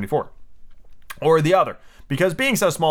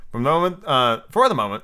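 plain reading voice

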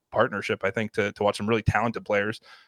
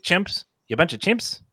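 put-on failed New Zealand accent, pitched up; a clumsy go at a New Zealand accent; bad New Zealand accent; 7 semitones higher, slower than usual. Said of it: "me trying to do a New Zealand accent and failing terribly".

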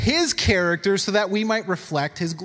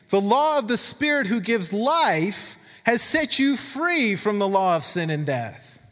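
slow, raised scripture reading voice; 4 semitones higher, slower than usual. Acted. Preacher reads Romans to the congregation, slow and raised. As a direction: read it out as the word itself, slowly.

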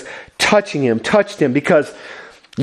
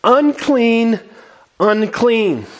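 a lightly voiced leper's cry, raised; reading the leper's cry with some lift; 6 semitones higher, much slower than usual. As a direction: call out Unclean as a warning. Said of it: "and cry out".

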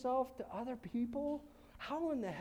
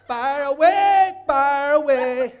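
slow, much louder taunt; defiant fire away; 4 semitones higher, 18 dB louder, slower than usual. Pretend. Pastor playing the believer taunting the devil's arrows. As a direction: dare them to shoot.

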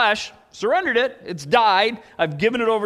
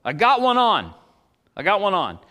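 louder fisherman's call; acted yell; 3 semitones higher, 4 dB louder than usual. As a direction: call it out down the pier, half-shouting. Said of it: "one guy yells out".

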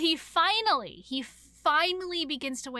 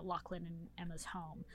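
normal talking voice

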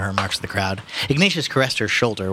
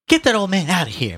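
high, raised demanding voice; indignant mother; 10 semitones higher, 4 dB louder than usual. A narrator's reading. Mother to the policeman, indignant.

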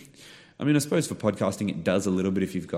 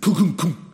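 imitated thuds; 8 semitones higher, 9 dB louder than usual. Imitating car hitting pigeons.

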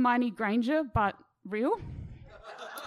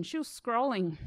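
usual speaking voice

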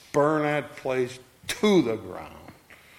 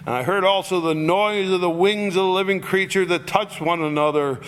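raised, ringing Bible reading; proclaiming the text to the congregation; scripture reading; 4 semitones higher than usual. Acted. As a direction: read the verses out with lift and force. Reads scripture aloud.

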